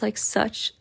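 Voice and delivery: ordinary delivery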